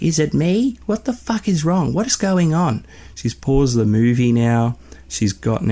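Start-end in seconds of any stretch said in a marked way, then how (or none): 0.0-2.8 high-pitched imitation of Nan losing it; frantic Nan. Nan panicking at the family mid-movie, frantic. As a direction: mimic her cooking herself, voice jumping up. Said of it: "cooking herself. She's like".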